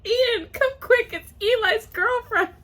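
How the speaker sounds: very high, loud, stretched-out call; excited mom. Acted. Mom calling someone to the son's girlfriend, squealing excitedly. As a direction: squeal it across the house.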